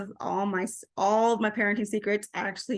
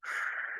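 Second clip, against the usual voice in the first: soft, audible calming breath; demonstrated breath; 11 dB quieter than usual. Shown as a demonstration. Mom showing upset daughter how to breathe, soft audible breath. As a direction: breathe slowly as if coaching a child.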